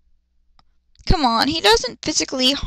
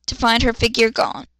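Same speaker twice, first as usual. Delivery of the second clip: ordinary talk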